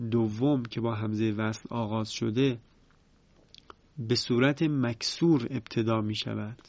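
normal talking voice

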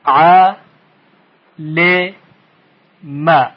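"ع، ل، م" as "'a, le, ma", long pauses between; three short syllables, each said separately; split into syllables. Shown as a demonstration. Teacher shows the word has three parts, syllable by syllable.

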